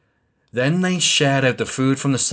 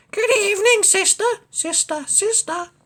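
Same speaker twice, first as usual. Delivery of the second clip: much higher duck calling her sister; quacking duck. Acted. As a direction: call into the cave sweetly. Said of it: "She quacked".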